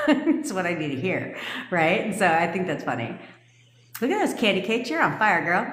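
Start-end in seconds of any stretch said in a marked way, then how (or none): none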